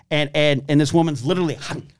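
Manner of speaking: ordinary talk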